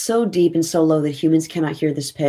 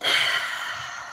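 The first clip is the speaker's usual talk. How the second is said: long exhale; 6 dB quieter than usual. Acted. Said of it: "She inhales really slowly. She exhales".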